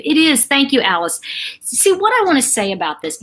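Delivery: usual speaking voice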